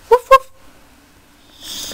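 high little puppy woofs; puppy bark. Pretend. Mother playing puppy for her baby, playful high barking. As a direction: bark like a small toy puppy. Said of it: "The puppy gonna go woof".

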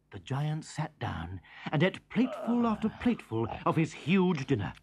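normal talking voice

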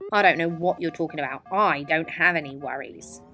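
fast, loud, brushed-off big brother; big brother, eyes still on his game; dismissive brother. Acted. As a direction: rattle it off like a brother who can't be bothered. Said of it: "all he said was".

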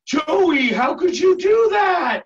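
gasp, then a loud, high, drawn-out scolding cry; exaggerated shock at a misbehaving kid; mock shocked surprise. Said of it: "like, you're really surprised".

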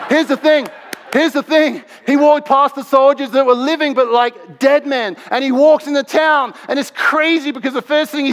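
high-pitched, excited preaching; getting excited. Real, not acted. Preacher telling how he walked past the soldiers, excited. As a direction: push the pitch up with real excitement. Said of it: "I'm getting excited".

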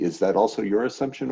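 ordinary talk

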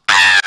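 low, loud startled "Ah!"; loud shocked mother's exclamation; mother's loud cry. Retold or imitated. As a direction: gasp out in alarm. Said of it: "My mother shouted".

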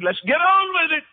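high, brisk let's-go voice; eager impatience. Retold or imitated. Jesus, in the preacher's telling, eager to face the cross, voiced high and impatient. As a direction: toss it off like he can't wait to start. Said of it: "I think Jesus' attitude".

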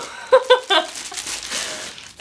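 a breathless high-pitched squeal of delight; high squealing laugh. Real, not acted. Opening a gift box, a surprised delighted squeal.

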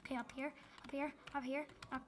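fast quiet hyperventilating breathy rush; hyperventilating, whispering directions fast. Real, not acted. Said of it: "I swear I'm hyperventilating".

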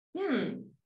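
usual speaking voice

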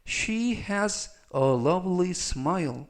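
slow, carefully spaced English sentence; slow model reading. Shown as a demonstration. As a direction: read each word slowly for learners. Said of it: "전체적 의미는".

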